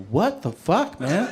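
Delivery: high, rising incredulous question; mock shocked disbelief. Patient hearing doctor's drug list, high mock shock. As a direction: let the pitch jump in disbelief.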